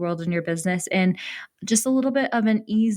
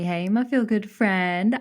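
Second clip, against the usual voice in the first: ordinary talk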